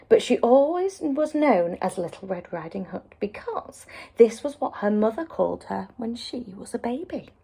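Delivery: ordinary delivery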